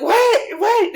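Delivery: very high, quick repeated question; hammed-up incredulous yelp; baffled squawk. Husband on the phone hearing about a gun, squawking in confusion.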